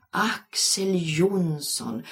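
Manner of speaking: slow, dreamy drawn-out name. A narrator's reading. Linda dreaming aloud over the teacher's name, dreamy. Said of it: "sa Linda drömmande".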